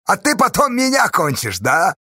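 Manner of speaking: high, jeering question; derisive, exaggerated singsong; mocking. A narrator's reading. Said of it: "насмешливо спросил Чупря".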